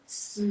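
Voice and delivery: se rising upward; second-tone demo. Shown as a demonstration. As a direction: lift se from mid to high. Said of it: "Kalau dia nada kedua".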